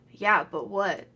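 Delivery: normal talking voice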